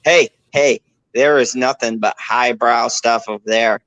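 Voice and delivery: normal talking voice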